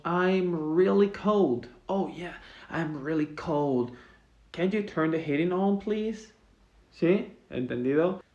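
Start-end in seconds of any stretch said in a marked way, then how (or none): 0.0-1.7 slow, clearly articulated English example; slow correct model. Shown as a demonstration. Teacher modeling the right phrase slowly for Spanish students. 1.9-6.3 acted cold speaker.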